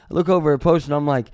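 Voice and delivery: ordinary talk, nothing marked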